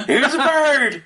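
bursting out laughing, high; giggly and high. Real, not acted. Laughing joke.

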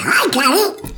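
high quacked reply, squawky; Donald Duck. Retold or imitated. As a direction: answer like an excited cartoon duck. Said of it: "talk like Donald Duck".

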